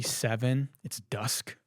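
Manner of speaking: ordinary talk, nothing marked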